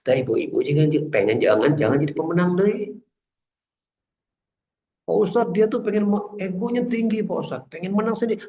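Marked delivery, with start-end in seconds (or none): 5.0-8.5 high-pitched wifely complaint; imitating a wife griping to her preacher; complaining wife. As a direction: plead the grievance in a raised pitch.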